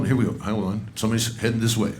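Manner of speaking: ordinary talk, nothing marked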